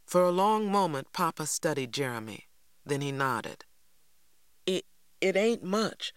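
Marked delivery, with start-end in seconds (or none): 4.7-6.1 halting, shy stammer on the repeated it; a shy boy stumbling over his words. A narrator's reading. A shy Jeremy stammering as he hands over his gift. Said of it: "stammered Jeremy".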